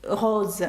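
"Роз" ending in a voiced Z; "Роз" with a buzzing Z ending; S read as Z. Shown as a demonstration. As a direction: say French "Роз" with a final Z. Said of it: "літера С читається як З".

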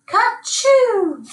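high baying "Ka-choo"; the sheep bay a sneeze; loudly bayed sneeze. A narrator's reading. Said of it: "loudly bayed".

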